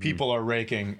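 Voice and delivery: usual speaking voice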